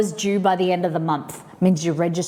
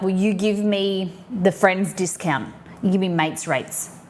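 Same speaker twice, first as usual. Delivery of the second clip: ordinary talk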